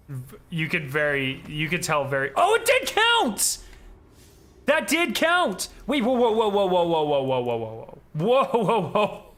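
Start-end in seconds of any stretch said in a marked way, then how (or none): 2.4-5.7 high, loud and drawn out in delight; slow, thrilled shout of victory; excited shout. Real, not acted. Game moment goes his way. 5.9-9.2 loud, high stream of whoa; startled, rising chant of whoa; excited whoas. Real, not acted. Hype reaction to game.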